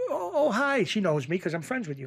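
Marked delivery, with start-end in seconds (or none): 0.1-0.8 much higher surprised woman voice; hammed-up surprised wife; mock wife. Acting the stranded wife.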